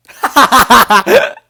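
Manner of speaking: high, loud burst of laughter; big high-pitched laugh; loud cackling laugh. Real, not acted. Cracks up mid pro tip.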